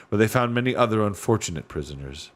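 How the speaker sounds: usual speaking voice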